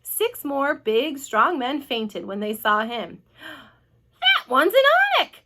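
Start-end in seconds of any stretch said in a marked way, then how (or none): 4.2-5.4 very high, loud shriek; panicked scream. A narrator's reading. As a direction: scream it in horror. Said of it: "screamed the head of the fire department".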